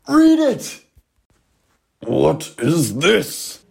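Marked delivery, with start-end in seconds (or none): 0.1-0.8 high loud urging; shouting. Acted. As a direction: shout it eagerly. Kids urge him on.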